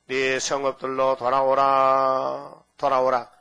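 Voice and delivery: slow, loud drawn-out call. Acted. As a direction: call them home from afar. Calling return. (길게 외치는 부름)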